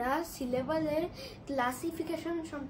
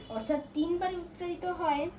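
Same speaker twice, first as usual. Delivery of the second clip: ordinary talk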